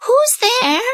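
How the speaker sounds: put-on high, frail old lady voice; high old woman asking at the door; old grandmother. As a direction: quaver like a kindly granny. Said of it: "grandmother asked".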